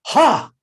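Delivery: high loud mocking ha; a short gleeful jab of a laugh; gloating ha. Hari Kesh when Prabhupada's chapati flops: high gloating ha.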